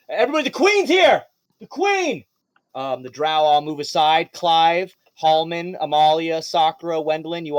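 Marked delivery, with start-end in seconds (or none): 0.1-2.2 calling out. Acted. Guard tells everybody the queen is here, shouting. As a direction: shout it to the whole crew, pitched way up.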